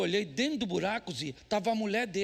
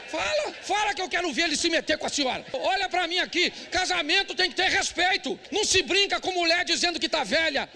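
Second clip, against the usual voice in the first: loud, high, driving sermon voice; preaching at full force; 7 semitones higher, 4 dB louder than usual. Real, not acted. Pastor scolding husbands from the pulpit, loud preaching. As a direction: hammer the point home to the men.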